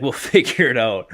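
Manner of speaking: mock the breezy reassurance through a laugh; laughing mimicry. Player repeating the coach's reassurance to his friend, laughing mimicry.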